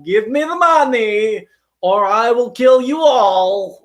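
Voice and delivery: slow, loud, high cartoon Russian threat; hammed-up Russian villain making demands; bad Russian accent. Actor imagining walking onto set, hammed-up bad Russian accent. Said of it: "that's not a Russian accent".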